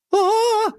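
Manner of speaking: very high-pitched shriek; squealing scream. A narrator's reading. Girl zapped by electric shock, squealing. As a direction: squeal at a sudden jolt. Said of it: "заверещала она".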